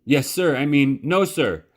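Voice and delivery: high, flustered yes sir; jumpy, tripping over the words; startled boy. Acted. Bryce startled by the spatula thwack, high and flustered.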